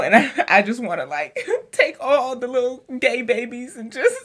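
laughing through the words; giggling while talking. Real, not acted.

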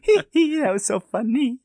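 squeaky giggling through the words; high cartoon giggle while talking; silly high laugh.

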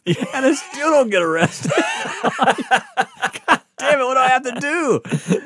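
mock exasperation. Teen marvels that he still isn't arrested, mock frustrated. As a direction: throw your hands up, laughing.

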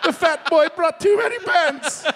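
put-on high, hammy Italian accent; exaggerated Italian accent impression. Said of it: "Kinda sounded Jamaican".

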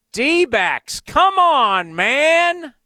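high, loud groan of frustration; exasperated cry. Real, not acted. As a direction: complain to the team out loud. Reacting to D-backs loss.

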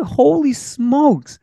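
amazed. Retold or imitated. Reacting to David's endorsement.

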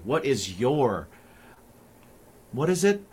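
normal talking voice

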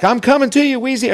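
lightly voiced sitcom dad faking a heart attack; Sanford and Son bit. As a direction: ham it up like a TV old man clutching his chest. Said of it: "it's Sanford and Son".

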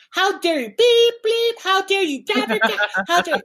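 high-pitched cartoon rant with bleeped swearing; mock yelling tirade. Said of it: "of course it sounded like this".

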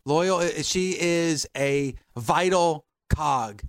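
low, slow, measured declaration; solemn defence. Real, not acted. Host vouching for his co-host's loyalty, solemn. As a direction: spell it out slowly and gravely.

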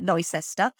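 Leicester said as LOY-sester; pronounced as spelt. Shown as a demonstration. Host explaining the misreading, Leicester said as LIE-sester. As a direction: read Leicester by its spelling, "lie-ses-ter". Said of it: "because it looks like Leicester".

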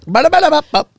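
high rattling ba-da-ba; imitated machine gun. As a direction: mimic rapid gunfire. Imitating gunfire sound.

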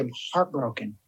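low, soft and deflated; a hushed admission of grief; quiet heartbroken. Retold or imitated. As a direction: let the word sink, heartbroken. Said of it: "How are you?".

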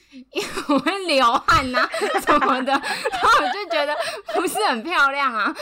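loud, high laughing speech; real laughter at her own silly reason; laughing while talking. Real, not acted. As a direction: giggle through the words.

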